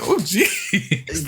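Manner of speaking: high, laughing swoon; flustered reaction. Retold or imitated. Retelling their reaction to the sexy voice, laughing and flustered. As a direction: react as if overwhelmed, amused. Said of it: "I'm like".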